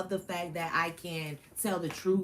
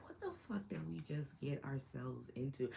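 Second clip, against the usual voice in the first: very soft, lower aside; stunned hushed thought; 5 semitones lower, 12 dB quieter than usual. Retold or imitated. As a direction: drop it to a hushed inner voice, retelling a shocked thought. Recruits' silent thought on bus.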